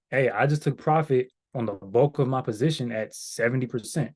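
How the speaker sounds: normal talking voice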